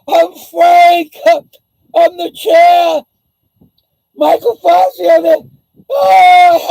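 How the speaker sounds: high, loud, hammed-up fake sobbing; mock drama queen wailing. Mocking Frank as a weeping drama queen, hammed-up wailing. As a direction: wail and shout like a crybaby, overdone. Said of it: "I'll be the drama queen, YouTube's biggest drama queen".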